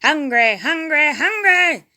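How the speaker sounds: loud, rising monster bellow; loud rising hungry roar; menace bellowing. A narrator's reading. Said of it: "bellowed the masked menace".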